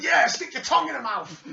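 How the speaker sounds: mock commentator yell, high and loud; Taz. Host imitating commentator during a kiss at ringside, yelling. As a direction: egg him on in a hammed-up shout. Mimics Taz egging on wrestler.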